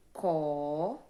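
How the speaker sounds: "Co" held long and loud alone; teacher's loud, stretched "Co"; isolated syllable. Shown as a demonstration. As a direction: sound out "Co" as one drawn-out syllable. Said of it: "que foi a primeira sílaba".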